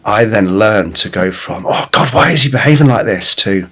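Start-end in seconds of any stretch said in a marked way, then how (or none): 1.7-3.3 frustrated, louder and higher; exasperated complaint, pitched up. Shown as a demonstration. Dad fed up with his son, exasperated. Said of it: "completely different tone".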